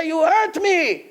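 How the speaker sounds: high, slow whine of the slapped man; slapped worshipper whining; hurt complaint. Retold or imitated.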